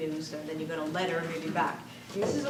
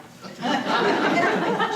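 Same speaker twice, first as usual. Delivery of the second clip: loud high laughing fit; burst of laughter; 10 semitones higher, 7 dB louder than usual. Real, not acted. Laughs at sister's letter.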